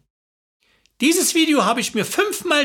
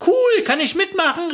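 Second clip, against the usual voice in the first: high, excited exclamation; thrilled, pitched right up; 8 semitones higher than usual. A narrator's reading. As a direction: squeal with eager excitement. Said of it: "rief sie aufgeregt".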